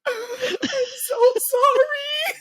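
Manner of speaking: very high, loud, slow mock sob; drunk wail. Drunk girl wailing sorry to friends in the car, hammed-up sob.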